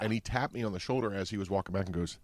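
ordinary delivery

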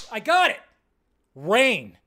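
a sudden high burst of fake inspiration; loud, pleased "I got it" as the clueless designer; mock eureka. Poster maker's eureka moment.

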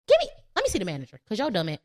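rushed, fast demand for the manager; impatient customer. Retold or imitated. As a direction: spit it out like a fed-up customer. Acting an angry customer.

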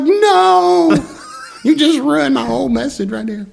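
shrieked high and loud with a laugh; mock dismay. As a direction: squeal it up high, half laughing. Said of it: "I was like".

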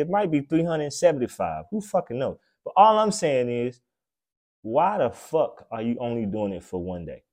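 usual speaking voice

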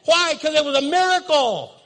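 loud slow closing sermon; preaching at full force. Acted. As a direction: drive the point home to the congregation. Sermon climax.

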